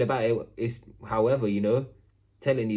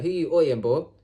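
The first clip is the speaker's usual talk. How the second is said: confrontational; 3 semitones higher than usual. Retold or imitated. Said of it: "What did I tell him?".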